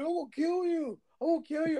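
put-on very high little-kid threat; mock mad kid. Kid threatening the gamer who teases him, mock mad. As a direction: imitate a furious child in a squeaky high voice. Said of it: "He got so mad".

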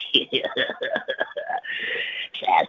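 long, voiceless mocking cackle; drawn-out jeering laugh; mocking elf cackle. Elves jeer at him.

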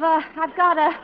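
ordinary talk, nothing marked

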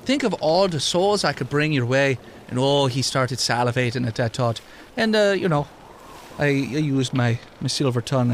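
put-on mildly Irish-tinged lilt for an old immortal NPC; Wisconsin Irish accent. GM voicing the immortal Tom to the players, light Irish brogue. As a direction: keep a light Irish brogue, wry and storytelling. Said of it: "He sounded like he was a Wisconsin Irishman".

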